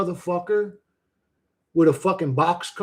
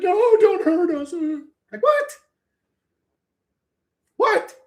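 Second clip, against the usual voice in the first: mock whimpering; 9 semitones higher, 3 dB louder, much slower than usual. Mocking the passengers begging.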